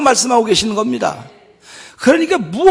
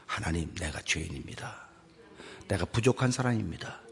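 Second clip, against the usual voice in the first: much softer and lower, a humble murmur; hushed confession; 13 semitones lower, 16 dB quieter than usual. Acted. Pastor voicing a sinner confessing to God, hushed and low.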